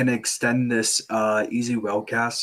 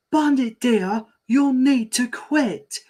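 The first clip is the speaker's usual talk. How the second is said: much higher, slow scolding mum; higher mom voice; 12 semitones higher, 4 dB louder than usual. Acted. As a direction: push the mum voice up high. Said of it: "retake, higher".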